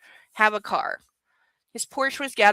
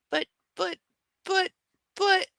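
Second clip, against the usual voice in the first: squeaky pet talk; 10 semitones higher than usual. Real, not acted. Mock protest to the dog.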